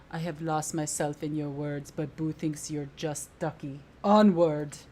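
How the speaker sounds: low, lightly acted hero ending on a loud rallying cry; a touch of heroic character, bursting out on the last word; lightly voiced game hero. Player reading Boo's friend rallying the party, lightly heroic.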